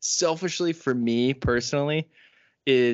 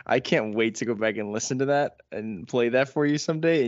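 ordinary talk, nothing marked

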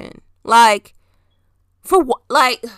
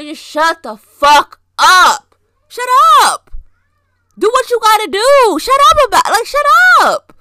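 much higher, louder fed-up yelling; furious shut up; 11 semitones higher, 7 dB louder than usual. Real, not acted. Telling show-off celebrities to shut up, loud high furious rant. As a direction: let it all out at them, loud and high.

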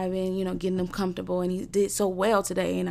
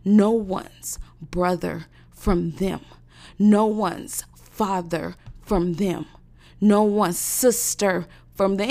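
slow, louder, each word hammered; slow furious list; 4 dB louder, slower than usual. Real, not acted. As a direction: build the fury. Slow list, anger rising.

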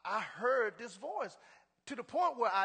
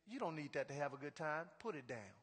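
soft, low, gentle instruction; a quiet lower voice, nothing thunderous; simple voice of God; 7 semitones lower, 10 dB quieter than usual. Retold or imitated. Preacher voicing God telling him to put the drink down, softly. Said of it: "That simple voice was giving me instructions".

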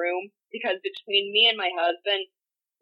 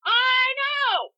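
excited shout; 13 semitones higher, 5 dB louder than usual. Real, not acted. Excited agreement.

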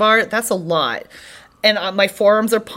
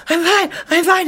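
acting out high, winded insistence; breathless reassurance; 10 semitones higher than usual. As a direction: gasp it out high, as if mid-workout. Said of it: "breathing really hard".

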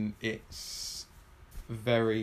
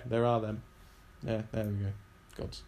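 ordinary delivery

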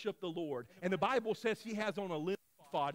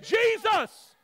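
very loud, high, drawn-out cry; defiant shouted plea; 12 semitones higher, 11 dB louder, much slower than usual. Acted. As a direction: holler it right over the crowd. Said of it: "And he says".

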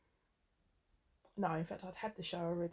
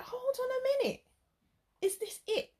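much higher, louder disbelief; incredulous; 5 dB louder than usual. Retold or imitated. Woman retelling her shock at her date, high and loud. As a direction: sound baffled that it's already over. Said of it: "Because I was like".

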